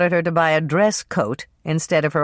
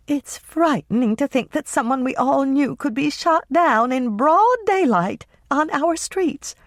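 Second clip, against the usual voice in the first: put-on much higher, slowing, shuddering voice of an older lady; frightened older woman; 10 semitones higher than usual. Carrie shivering about the murder, high and frightened. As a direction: let the fear rise into a high quaver. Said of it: "Carrie shivered slightly".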